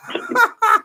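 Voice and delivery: very high-pitched giggling laugh; high laughter. Real, not acted. Joker amused by the cartoon comparison, high laughter.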